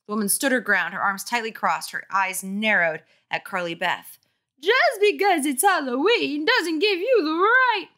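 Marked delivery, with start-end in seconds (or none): 4.6-7.9 shrill scolding mom. Acted. Angry mother scolding.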